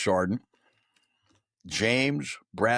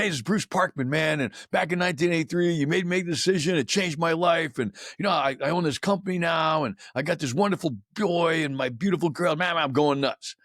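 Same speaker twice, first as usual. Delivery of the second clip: high, breathless-sounding rush of news, re-enacted; retold gushing excitement; 8 semitones higher than usual. Former soldier phoning his old first sergeant, retold excitement. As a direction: gush to an old mentor, pitched well up.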